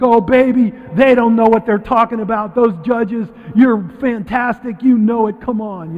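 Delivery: high, loud mock mother's reassurance; indignant doting mom. Mom consoling her rejected singer, loudly doting. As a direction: gush over your child and wave off the critics. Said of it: "but there's mom".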